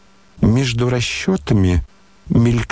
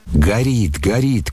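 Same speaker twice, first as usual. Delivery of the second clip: ordinary delivery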